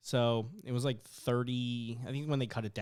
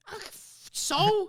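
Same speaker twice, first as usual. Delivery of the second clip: dismissive retort; 6 dB louder, slower than usual. Retold or imitated. Dismisses parents' theft worry.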